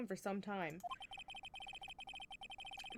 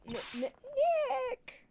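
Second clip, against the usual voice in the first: higher, slow, tearful stammer; sobbing stammer; 6 dB louder, much slower than usual. Acted. [name] crying to Nick on the phone, hammed sob. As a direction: blubber his name through mock tears.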